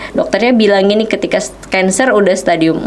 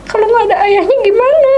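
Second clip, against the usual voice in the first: higher, pleading protest rising in pitch; complaining to God; 12 semitones higher than usual. Retold or imitated. Wife protesting to God about her sick husband. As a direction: cry out to God, hurt and angry. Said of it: "aku bilang".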